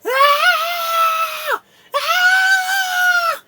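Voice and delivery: very high, loud, long shriek; scream picking up. Acted. Guard in agony before passing out, escalating scream. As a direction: shriek at the top of the range. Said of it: "starts to pick up a little bit".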